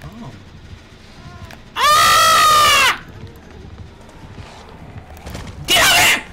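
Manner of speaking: high panicked screams; panicking gamer. Real, not acted. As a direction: shriek in sudden fright. Jump scare in horror game.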